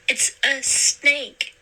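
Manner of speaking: slow, tight voice fighting panic; holding back hysteria. A narrator's reading. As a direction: say it slowly, forcing calm. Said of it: "trying not to sound hysterical".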